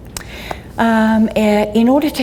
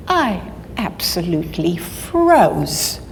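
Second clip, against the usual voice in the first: ordinary delivery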